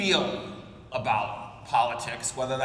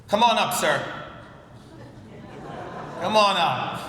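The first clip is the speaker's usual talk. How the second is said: calling out; 2 semitones higher than usual. Real, not acted. Calling guest to the stage.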